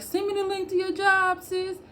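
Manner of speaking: high, sing-song pleading mimicry; mock a high-pitched, eager asker; imitated job-seekers. Everyone asking her for the job link, high mocking imitation. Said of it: "Everybody just be like".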